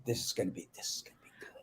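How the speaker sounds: unvoiced whisper to himself; hushed whisper. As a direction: whisper it like a secret thrill. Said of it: "I went".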